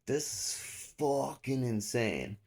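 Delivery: high, very drawn-out protest; retold disbelief. Suspect being kneed by cop, slow high retold disbelief. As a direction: stretch each word in mock amazement. His disbelief during the arrest.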